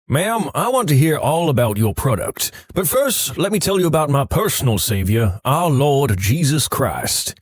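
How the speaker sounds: evangelist voice slowing right down at the end; mock preacher. Interrupting a telemarketer with a slow mock sermon. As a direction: preach at the caller, stretching out the Saviour's name. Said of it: "I always interrupt early and say".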